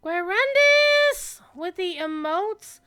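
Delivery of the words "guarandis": high, slow delighted yell; excited shout. Real, not acted. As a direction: call the name out joyfully. Excited call to a friend.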